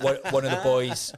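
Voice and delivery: ordinary talk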